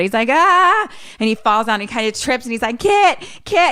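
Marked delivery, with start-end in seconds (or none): 0.3-0.9 short, high imitated scream; mock scream. Rob falls out of the pantry, mock screaming. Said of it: "screaming a little bit". 2.8-3.7 high, loud panicked calls; mock screaming. Rob stumbles through the house for Kit, mock screaming. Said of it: "screaming a little bit".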